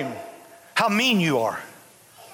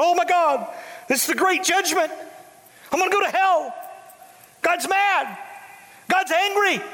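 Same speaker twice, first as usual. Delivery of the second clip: high, frantic, panicked cry; a crippled man begging the king for mercy; terrified pleading; 9 semitones higher than usual. Acted. Mephibosheth falling at David's feet, high panicked plea.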